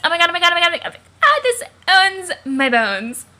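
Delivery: high, loud ecstatic squealing; shrieking jackpot winner. Acted. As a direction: shriek with delight like you won big. Roxy thrilled by the artwork.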